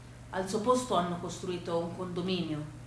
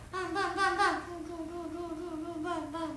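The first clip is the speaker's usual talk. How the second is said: mock gunfire; 10 semitones higher, 4 dB quieter, slower than usual. Western shootout replayed softer.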